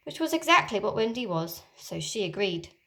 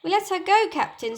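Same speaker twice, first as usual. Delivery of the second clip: high pirate voice; 10 semitones higher, 4 dB louder than usual. Acted. Smee answers Hook.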